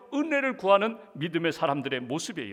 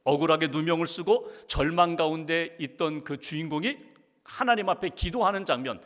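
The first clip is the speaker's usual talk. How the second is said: usual speaking voice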